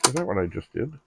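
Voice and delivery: ordinary delivery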